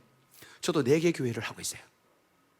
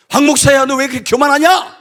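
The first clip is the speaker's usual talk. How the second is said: loud, high voice of God scolding the pastor; God rebuking; 10 semitones higher, 18 dB louder than usual. Retold or imitated. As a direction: thunder the rebuke down from above. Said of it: "주님이 나보고 했던 말이".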